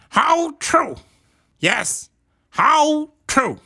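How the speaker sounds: high, loud kangaroo exclaiming; eager agreeing kangaroo. A narrator's reading. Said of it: "said the big kangaroo".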